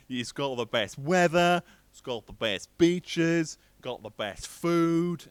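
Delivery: slow, high, loud Aussie boasting; bad Australian accent. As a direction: draw it out in a mock Australian accent. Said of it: "I can't do accents".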